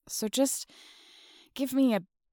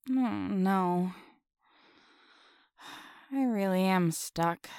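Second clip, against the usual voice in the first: ordinary delivery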